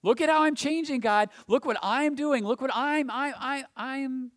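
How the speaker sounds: hammed-up boastful plea that trails off stammering; presumptuous pray-er. Preacher mocking a proud worshipper demanding God notice him, loud and insistent. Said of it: "You don't say".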